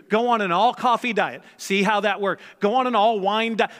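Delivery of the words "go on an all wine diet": ordinary talk, nothing marked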